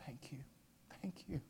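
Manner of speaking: imitated hushed, grateful whisper; whispered thanks. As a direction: whisper thanks as if deeply moved. Retold moved, grateful old man.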